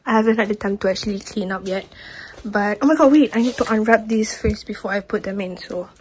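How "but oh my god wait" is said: normal talking voice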